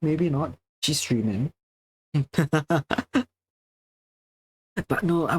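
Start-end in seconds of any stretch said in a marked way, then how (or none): 2.1-3.2 light run of giggles; laugh. Real, not acted. Teasing a streaming friend who may listen, giggling. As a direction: chuckle in a quick series.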